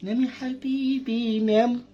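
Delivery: tender drawn-out bedtime coaxing; slow soothing coax. Acted. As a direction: stretch it out gently to lull him. Coaxing toddler to sleep.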